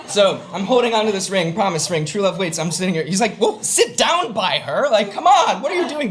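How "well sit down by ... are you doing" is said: high, louder mock scolding; exasperated urging. Boss orders him down by her, exasperated.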